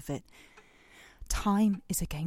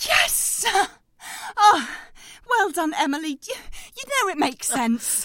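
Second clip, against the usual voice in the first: much higher, louder jubilant cry; triumphant; 12 semitones higher, 8 dB louder than usual. Acted. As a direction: celebrate the win. Triumph when the girl gives in.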